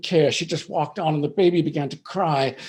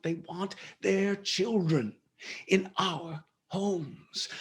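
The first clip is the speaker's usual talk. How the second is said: usual speaking voice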